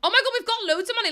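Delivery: fast, high, loud burst of delight; excited. Real, not acted. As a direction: gush it like you just found a fortune. Delight at game money.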